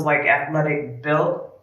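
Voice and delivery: ordinary delivery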